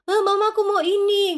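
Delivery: put-on whiny child. Whiny child asking mother.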